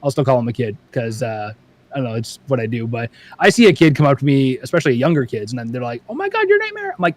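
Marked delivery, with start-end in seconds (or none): 6.1-6.9 put-on very high, squealing kid voice; excited kid. A young kid fan recognising the YouTuber, very high and excited. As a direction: squeal it like a little kid meeting a star.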